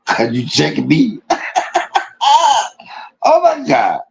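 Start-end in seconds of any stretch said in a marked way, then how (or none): 1.2-2.7 shrieking high laugh; helpless high-pitched laughter. Real, not acted. Laughing at the story. 3.2-4.0 laugh-crying oh my God; weeping with laughter. Real, not acted. Overcome with laughter.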